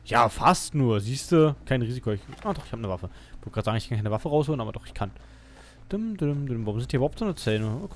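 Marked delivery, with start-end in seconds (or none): none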